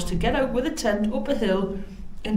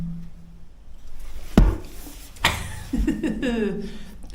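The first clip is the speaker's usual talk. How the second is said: long laugh. Real, not acted. Laughing at her own muddle.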